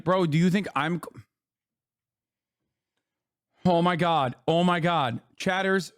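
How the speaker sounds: ordinary talk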